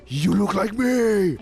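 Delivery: high, loud and slow, stunned; shocked exclamation. Retold or imitated. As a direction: blurt it out in shock at a lookalike. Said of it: "not in a friendly way, but, like, in a shocking way".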